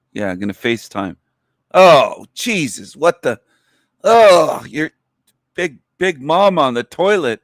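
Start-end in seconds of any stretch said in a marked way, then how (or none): 1.7-7.4 shocked exclamation. Real, not acted. Sees mom on the toilet.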